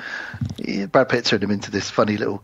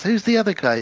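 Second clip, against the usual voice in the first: pitched-up quoted question; high character voice; 8 semitones higher than usual. Retold or imitated. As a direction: voice a startled stranger high. Retelling movie dialogue, high voice.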